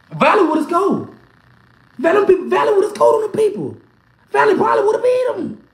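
rising mockery. Real, not acted. Building mocking claims.

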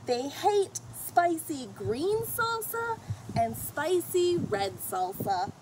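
plain reading voice

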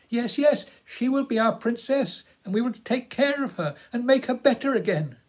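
put-on high, happy girl's voice; bubbling over with delight; delighted young girl. A narrator's reading. Girl delighted about the rescued goose, high happy voice.

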